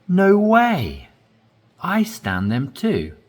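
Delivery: No way said high and loud in surprise; surprised emphasis. Shown as a demonstration. Teacher demonstrating surprise for learners, high and loud. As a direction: burst out No way, amazed. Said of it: "So you're emphasizing".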